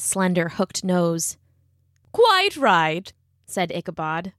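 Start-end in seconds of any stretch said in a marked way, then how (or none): 2.1-3.1 higher, prissy know-it-all; haughty little sniff of a reply; smug schoolteacher. Acted. Ichabod voiced, smug.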